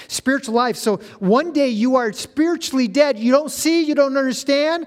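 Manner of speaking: high, building sermon climax; excited pulpit crescendo; impassioned preaching. Real, not acted. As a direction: preach it with rising force up to a loud burst.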